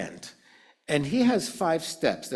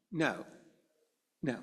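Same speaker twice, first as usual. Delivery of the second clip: ordinary talk, nothing marked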